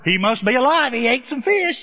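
high, loud, silly disciple voice; mock amazed disciples. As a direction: ham up their amazement at the fish.

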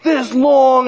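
put-on like a thrilled kid with a fish story; excited boast. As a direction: brag about the catch with rising excitement. Excitedly repeats the fish's size.

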